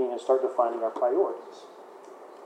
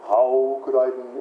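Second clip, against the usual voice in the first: slow, lightly voiced cow, read as to kids; slow storybook cow; 3 semitones lower, slower than usual. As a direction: drawl it out like a picture-book cow. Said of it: "that's how they read kids".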